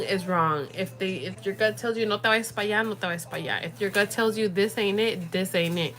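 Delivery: ordinary delivery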